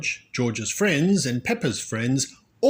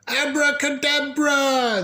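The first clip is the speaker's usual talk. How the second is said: put-on much higher, louder chorus of kids; children crying out; 4 dB louder than usual. A narrator's reading. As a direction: call the magic word like eager kids. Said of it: "the children cried".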